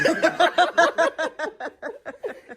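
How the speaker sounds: high burst of laughter. Real, not acted. Laughter after a price joke.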